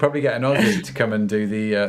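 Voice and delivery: normal talking voice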